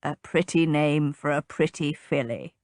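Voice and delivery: put-on low old gentleman voice; grandfather pronouncing. A narrator's reading. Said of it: "the old man pronounced".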